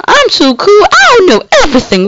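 high, put-on bragging voice; hammed-up high-voiced show-off; mock boasting. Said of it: "I'll be like".

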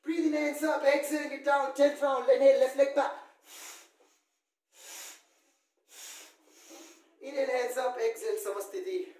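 called yoga cues. Acted. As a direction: call each step out over the room. Calls sun salutation rounds.

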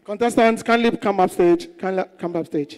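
loud call from the stage; stage host. Acted. Host calling the contestants up on stage, loud. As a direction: summon them up like an MC.

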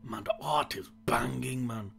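whispering. Real, not acted. Hushed comment while playing.

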